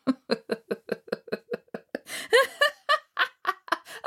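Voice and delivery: high hearty mocking laugh; big belly laugh. Odin laughs at the price.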